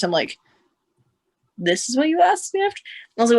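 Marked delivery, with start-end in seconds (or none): 1.6-2.8 high, rising incredulous question; retold disbelief. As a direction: climb in pitch as if you can't believe it. Retells disbelief at his question.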